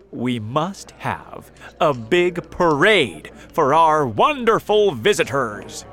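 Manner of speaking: loud public announcement; grand proclamation. A narrator's reading. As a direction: declare it proudly to a crowd. City leaders make grand proclamation.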